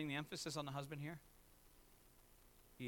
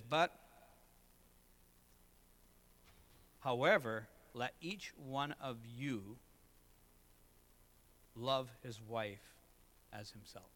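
slow scripture reading; 4 semitones lower, slower than usual. Shown as a demonstration. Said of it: "He says".

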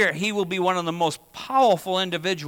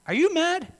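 much higher, insistent mock question; exasperating third ask; 10 semitones higher than usual. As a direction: push the question once more, pitched way up. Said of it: "I'll give you the classic example".

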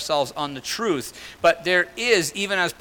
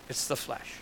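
whispered; 3 semitones lower, 13 dB quieter than usual. Acted. Driving home the sermon's point.

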